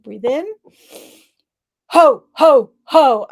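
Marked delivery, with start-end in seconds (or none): 1.9-3.3 loud, forceful ho ho ho; forceful ho laugh. Shown as a demonstration. Coach leading the group in forceful ho ho ho. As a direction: throw the ho ho out from the belly. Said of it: "forceful ho, ho, ho".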